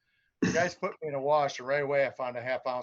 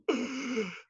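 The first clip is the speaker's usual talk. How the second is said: mock gasp; 10 semitones higher than usual. Retold gasp at empty hole.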